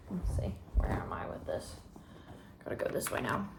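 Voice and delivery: quiet, under the breath; mumbling while concentrating; muttering to self. Real, not acted. Muttering to herself while cutting.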